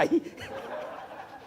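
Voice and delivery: quiet unvoiced chuckle; breathy laugh. Real, not acted. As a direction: laugh softly at the joke. Laughs at own joke.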